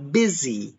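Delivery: "busy" said as BIZ-ee, short i, S as Z; clear "busy". Shown as a demonstration. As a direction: model "busy" with an I sound. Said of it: "we pronounce it I".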